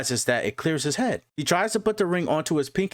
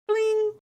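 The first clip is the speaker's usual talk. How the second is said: very high, sustained "bling"; ping like a phone alert in a high, held note; imitated notification chime.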